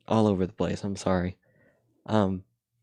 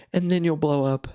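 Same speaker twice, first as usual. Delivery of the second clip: SpongeBob voice; 9 semitones higher, 5 dB louder than usual. Retold or imitated. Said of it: "he goes".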